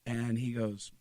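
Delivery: ordinary talk, nothing marked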